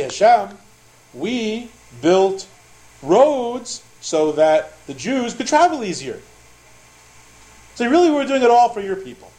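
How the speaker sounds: acted-out excuse, pitched well up; making excuses. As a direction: plead your case in a high, put-on voice. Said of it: "they'll have excuses".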